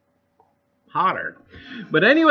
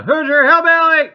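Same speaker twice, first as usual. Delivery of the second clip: playful shout; 9 semitones higher, 12 dB louder than usual. Real, not acted. Loud greeting to chat lurkers.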